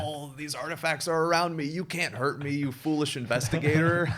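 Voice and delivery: gloating evil sorcerer voice; mad scientist. Retold or imitated. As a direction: cackle and gloat over your power. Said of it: "and he's like".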